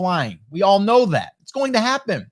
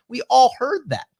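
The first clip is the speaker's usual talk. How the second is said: ordinary delivery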